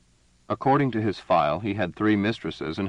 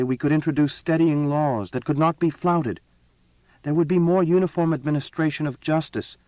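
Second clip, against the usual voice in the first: raised, demanding empress voice; imperious empress; 7 semitones higher than usual. A narrator's reading. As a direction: press him like a cold ruler. Empress argues her case.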